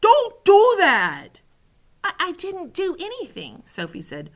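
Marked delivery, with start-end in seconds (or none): 0.0-1.4 slow, high, very loud protest; pained yell. A narrator's reading. Giant begs the girl to stop, yelling in pain. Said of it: "yelled the B F G". 2.0-3.6 put-on high, stammering girl's protest; startled protest. A narrator's reading. Sophie answers the yelling giant, startled and stammering.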